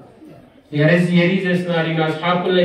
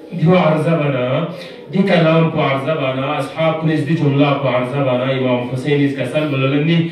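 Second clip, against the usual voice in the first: normal talking voice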